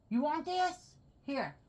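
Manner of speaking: high-pitched offer to a dog; lifted pet-owner voice; coaxing pet talk. Real, not acted. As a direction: coax the dog with a treat.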